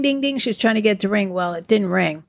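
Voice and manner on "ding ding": a higher, half-hearted ding ding; muffled bell imitation. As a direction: mimic a bell that won't ring. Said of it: "she's trying to ding".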